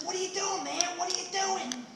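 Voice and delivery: high, eager voice; lightly voiced backwoods hermit; excited hermit. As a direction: pepper him with questions. Hermit questioning the stranger.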